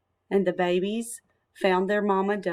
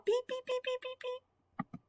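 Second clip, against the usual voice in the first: very high, much softer chick peeps; tiny peeps; 11 dB quieter than usual. Acted. Ducklings peeping for Pip, high and very soft. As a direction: peep faintly like tiny chicks.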